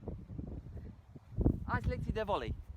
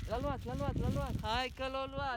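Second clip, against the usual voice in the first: delighted excitement; 8 semitones higher than usual. Real, not acted. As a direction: cheer that it took the bait. Gull takes the offered food.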